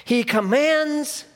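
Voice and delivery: high, loud, fast preacher's cry; full-force preaching. Acted. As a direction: preach it out to the whole hall.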